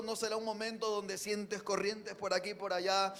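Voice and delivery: ordinary talk, nothing marked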